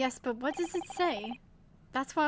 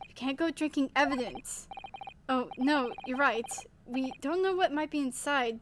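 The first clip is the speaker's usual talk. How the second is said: ordinary talk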